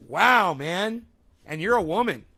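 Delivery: loud indignant scoff; outraged disbelief. Real, not acted. Outraged at commenter's remark.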